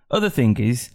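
ordinary talk, nothing marked

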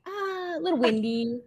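playing it cool. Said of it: "kind of trying to play it cool".

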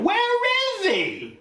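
very high, broken-up accusing cry; screeching divorced mother. Acted. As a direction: shriek the first word, then demand. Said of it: "Mom, you don't talk like that".

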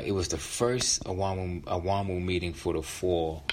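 ordinary delivery, nothing marked

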